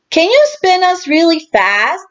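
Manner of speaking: high, eager little pig voice; bright child character voice; Peppa asking. A narrator's reading. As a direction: ask like an excited little girl. Peppa's excited request.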